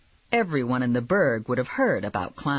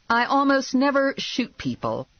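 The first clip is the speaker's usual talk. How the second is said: high-pitched, defensive reply; defensive protest; 10 semitones higher than usual. A narrator's reading. Bounty hunter to cop who knows the reputation, defensive. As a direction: protest your innocence.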